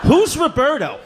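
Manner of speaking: high-pitched indignant question; baffled outburst. Acted. Son answering dad's wild accusation, high and baffled. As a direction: blurt it high in bewilderment.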